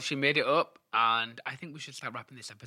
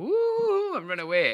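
high swooping "ooh", louder; panicky-pantomime high loud exit cry; Buttons; 6 dB louder, slower than usual. Retold or imitated. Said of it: "you see Buttons go".

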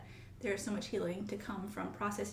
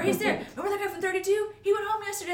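higher, quick, cheery colleague voice; imitating Clayton; 11 semitones higher, 7 dB louder, faster than usual. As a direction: bring good news to a tired nurse, bright and high.

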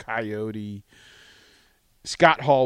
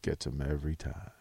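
smug aside; 10 semitones lower, 11 dB quieter than usual. Retold or imitated. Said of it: "and he goes".

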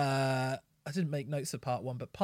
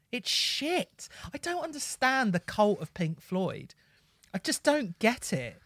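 high-pitched, baffled ranting; real exasperation; 7 semitones higher than usual. Real, not acted. Critic ranting about the cult of Pink Floyd, exasperated. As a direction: rant in raised, incredulous pitch.

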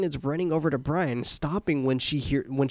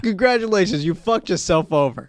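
mocking; 3 semitones higher, 6 dB louder than usual. Mocking the character.

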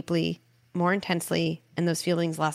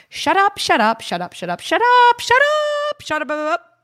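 much higher and louder, playful repeated yelling; silly shouting; 9 dB louder than usual. Shown as a demonstration. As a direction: blast it out like a goofy tantrum game. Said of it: "And I'd say this in the room".

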